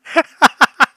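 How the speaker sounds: high, loud burst of laughter; helpless shrieking laugh. Real, not acted. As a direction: cackle out loud in disbelief. Laughing fit at the text.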